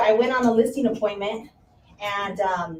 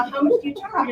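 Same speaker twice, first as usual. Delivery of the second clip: higher, laughing repeat of the question; quote through laughter; 6 semitones higher than usual. Retold or imitated. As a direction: retell her line while cracking up. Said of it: "So she said".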